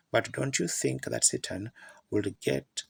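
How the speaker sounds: usual speaking voice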